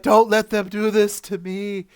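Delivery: slow, high, loud pleading; begging. Retold or imitated. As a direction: drag out a desperate plea. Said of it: "Tuvix was begging for his life".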